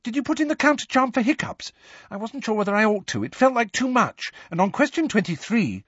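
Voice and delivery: high, rapid fretting; anxious exam-taker. Acted. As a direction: gabble worriedly in a high voice.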